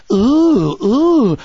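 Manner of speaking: high, loud, mocking "ooh"; a loud, mocking, high-pitched "ooh"; mock awe. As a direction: coo as if thrilled by power. Mock awe at killing power.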